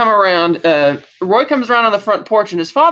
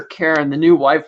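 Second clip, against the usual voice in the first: usual speaking voice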